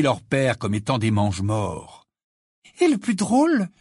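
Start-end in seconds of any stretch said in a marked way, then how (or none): 2.8-3.7 high-pitched, gleeful confiding; bubbling with glee, voice lifted high; joyful. A narrator's reading. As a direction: share it with delight. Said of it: "chuchota Hermione d'un air joyeux".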